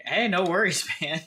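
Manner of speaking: ordinary delivery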